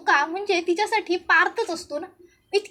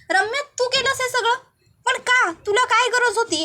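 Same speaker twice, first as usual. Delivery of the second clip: angry; 6 semitones higher than usual. Acted. Parth angry at Ramya.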